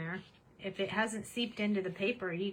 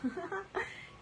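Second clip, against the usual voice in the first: delighted laugh; 10 semitones higher, 7 dB quieter than usual. Real, not acted. Big reveal of the print.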